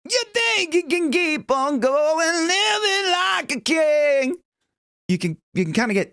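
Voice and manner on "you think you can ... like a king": muffled, clenched singing tone; muffled, closed off. Shown as a demonstration. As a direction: sing it clenched up, like into a pillow. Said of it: "It sounds closed off".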